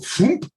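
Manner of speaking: a high, sudden "Foomp" sound; imitated shutting-down noise. Showing how a crier shuts down, a high mock "Foomp". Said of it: "They're gonna go".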